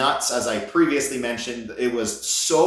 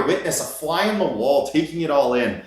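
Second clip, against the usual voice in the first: ordinary talk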